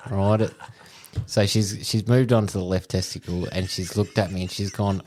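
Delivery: ordinary talk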